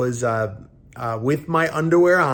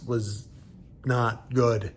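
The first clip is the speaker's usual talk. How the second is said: ordinary talk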